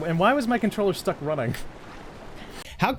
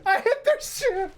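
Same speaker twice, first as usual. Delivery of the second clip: higher, slow, near tears from laughing; losing it; wheezing repeat; laughing harder; 13 semitones higher, slower than usual. Real, not acted. Laughing at own blunder.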